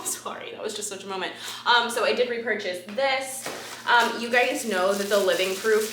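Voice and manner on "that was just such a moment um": ordinary talk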